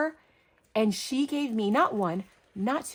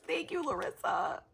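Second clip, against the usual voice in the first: ordinary talk, nothing marked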